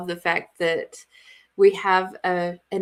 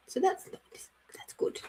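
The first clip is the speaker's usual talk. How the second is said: whispered aside; 8 semitones higher, 10 dB quieter than usual. Real, not acted. Hushed aside.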